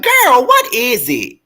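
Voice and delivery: high, slow exasperated demand; retold sass to mama. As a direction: act out a fed-up daughter, stretched out. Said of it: "I do talk to my mama like that".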